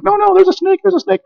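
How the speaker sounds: high, whiny frightened voice; scared girl refusing. Retold or imitated. Girl refusing the preacher's help, high scared whine. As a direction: plead in a scared little girl's voice.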